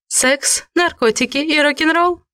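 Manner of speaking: husky, high-pitched answer; hoarse. A narrator's reading. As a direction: rasp it out nervously. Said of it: "хрипло ответила я".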